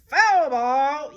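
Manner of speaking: loud stadium shout; crowd yell. Acted. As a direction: yell it across the stands. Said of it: "yells the".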